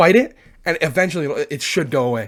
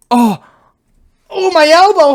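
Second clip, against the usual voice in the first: high, loud acted cry over an elbow; hammed-up yelp of pain; 11 semitones higher, 8 dB louder than usual. Vlogger pretending to hit his funny bone, loud high yelp.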